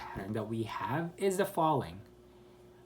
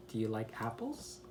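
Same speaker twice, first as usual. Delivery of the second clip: softer, with "apples" lifting upward; rising intonation; 2 semitones lower, 6 dB quieter than usual. Shown as a demonstration. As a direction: quietly raise "apples" at the end. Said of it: "Goes up".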